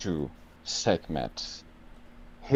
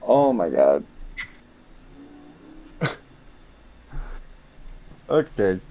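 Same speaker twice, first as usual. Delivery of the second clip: usual speaking voice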